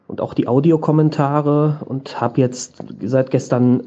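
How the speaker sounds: ordinary talk, nothing marked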